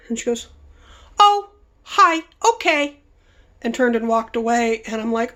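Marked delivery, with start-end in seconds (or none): 1.2-3.0 much higher, loud mock hostess voice; imitating the hostess. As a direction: do her miffed little oh hi. Hostess's offended reply.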